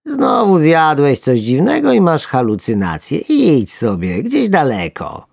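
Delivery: high, slow dismissive worm; dismissive sleepy worm. Acted. Sleepy worm tells the alarmist to go away. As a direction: brush him off.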